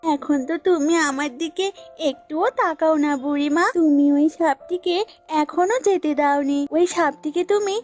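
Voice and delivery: lightly voiced goat, put out; jealous goat. Goat warns old woman about the snake, mildly jealous. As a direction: warn her with a touch of jealousy.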